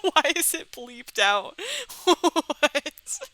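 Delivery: real laughter through the words; laughing. Real, not acted. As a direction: crack up in disbelief. Cracks up at the bleeped word.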